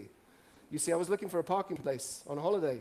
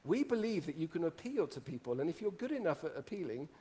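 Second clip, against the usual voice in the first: ordinary delivery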